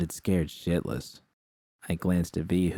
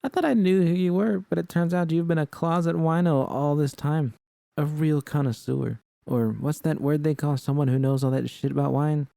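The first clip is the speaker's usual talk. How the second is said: much higher teasing voice; 8 semitones higher than usual. Acted. V teasing Judy about the wine, high and playful. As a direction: rib her about being a wino.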